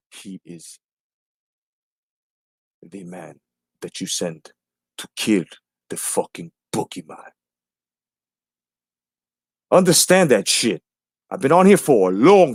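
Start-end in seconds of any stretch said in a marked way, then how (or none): 0.1-7.3 near-silent menacing whisper, much softer; hushed movie line. Retold or imitated. Quoting the John Wick legend to the chat in a whisper. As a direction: breathe the line like a movie threat.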